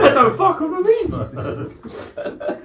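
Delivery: high, slow, cracking with laughter; laughing. Real, not acted. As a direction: squeeze it out mid-laugh. Laughing hard.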